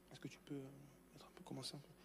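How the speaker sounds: quick whispered request off-mic; whispered aside. Real, not acted. Preacher to the sound tech, a hushed whisper. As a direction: whisper it to the tech so the room won't hear.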